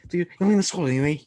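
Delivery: horrendous mock Scouse accent. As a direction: put on a hammed-up Scouse accent. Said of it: "it was a Scouse accent".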